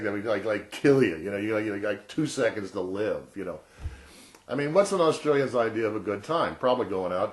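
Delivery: ordinary talk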